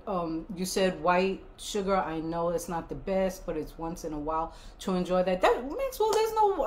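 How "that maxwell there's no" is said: high teasing voice. Real, not acted. Teasing viewer.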